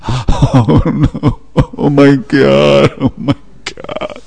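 high, slow gasping oh my God, fading to a breath; aghast, voice rising in shock; horrified owner hearing the news; shocked dismay. Acted. Man hears his cat died.

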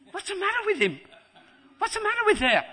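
very high, loud parent's cry; high-pitched acted exasperation; exasperated parents. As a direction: shout it like a parent at wits' end. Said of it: "and the parents say".

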